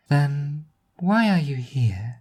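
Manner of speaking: put-on the strange girl questioning Nadine; slow eerie girl question. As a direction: ask it slowly and evenly. Eerie child asks.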